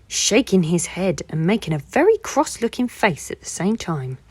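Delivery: plain reading voice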